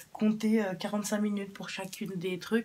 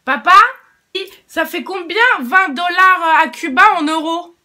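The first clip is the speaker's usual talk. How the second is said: loud, high call to someone far off; raised voice calling dad from another room; 10 semitones higher, 20 dB louder than usual. Real, not acted. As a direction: shout across the house to dad. Calling her father in another room.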